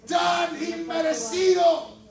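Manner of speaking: loud, high, fervent thanks shouted to God; shouted prayer. Shown as a demonstration. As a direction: cry it out to the Lord with full force. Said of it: "Le puedes decir".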